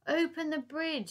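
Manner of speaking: high, loud slow shout. Acted. Elsie shouting to the gang on the bridge, loud order. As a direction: bellow the order up. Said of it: "she shouted back".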